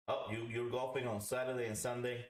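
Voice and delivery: ordinary talk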